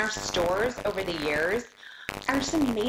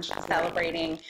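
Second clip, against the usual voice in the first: usual speaking voice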